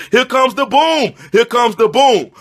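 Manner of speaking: loud hyped chant; hype shout. Pretend. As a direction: announce the boom like an arena. Hyped announcer catchphrase.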